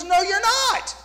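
high, loud indignant protest; indignant son. Retold or imitated. Son on the phone insisting to his mom, high loud protest. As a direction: insist she is wrong, loudly.

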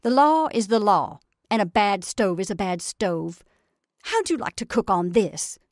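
loud, pushing back hard on "law"; aggressive. A narrator's reading. Farm wife retorting to the sheriff's wife, loud aggressive outburst. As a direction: throw her words back at her, forcefully. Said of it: "she said aggressively".

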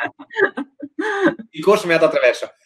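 hiccup jolting the voice up; hiccuping, words catching in the throat. Real, not acted. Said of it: "mi è venuto il singhiozzo".

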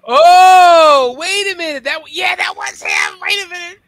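very high, shouted burst; real high-pitched astonished outburst; excited realisation. Real, not acted. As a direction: shriek it as it suddenly clicks. Sudden realisation about the film.

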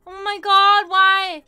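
loud high shriek of fear; panicked shriek. Real, not acted. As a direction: scream it out in terror. Panic at monster nearby.

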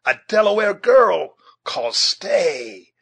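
high, loud, slow call; ringing, drawn-out challenge; defiant shout. A narrator's reading. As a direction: holler it after a fleeing enemy, drawing out the words. Uncas calls after fleeing foe.

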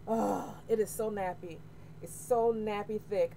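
normal talking voice

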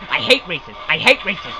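low, soft grumbled chant; sullen muttering. Real, not acted. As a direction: grumble it to yourself. Muttering after losing again.

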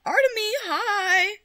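high, drawn-out excited hello; excited greeting. Real, not acted. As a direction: squeal a happy hi.

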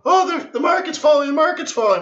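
high mock-panicked cry; panicked friend. Friend at lunch fretting about stocks, high mock panic. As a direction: squeal it like a worried friend. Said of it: "Stock market comes up".

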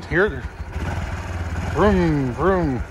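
usual speaking voice

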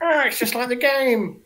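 high, excited gamer voice; excited fan. Retold or imitated. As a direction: gush like a thrilled fan. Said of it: "And you go".

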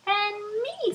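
high, loud little flea; a tiny flea chiming in. Acted. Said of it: "said the flea".

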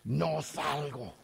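low, slow, defiant growl; demon voice. Retold or imitated. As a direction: drag the refusal out like a demon. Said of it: "Y el demonio dice". (demonio grave y lento, desafiante)

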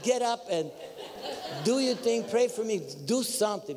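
high, urgent comic plea; mock desperate demand. Seeker urging the fallen minister, high mock desperation.

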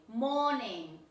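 "morning" as "mo-ning", r dropped; correct silent R. Shown as a demonstration. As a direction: model "morning" with a long vowel and no r. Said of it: "no morning".

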